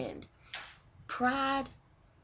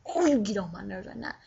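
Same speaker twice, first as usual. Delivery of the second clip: raised, louder teasing complaint; annoyed partner; 5 dB louder than usual. Retold or imitated. A partner griping at the other, mock-annoyed and loud. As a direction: play the irritated partner, half joking. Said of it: "where you're like".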